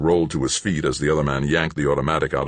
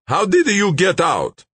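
high, loud demand; shouted; 6 dB louder than usual. A narrator's reading. Gunman confronts escaped captives, shouted demand. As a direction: shout the question, pitched right up. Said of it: "a man shouted".

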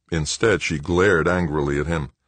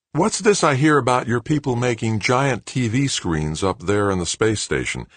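put-on higher-pitched voice for a young protege; young executive quizzing a colleague, pitched up; young board member; 7 semitones higher than usual. A narrator's reading. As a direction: pipe up with a higher questioning voice. Newest board member questions Paul.

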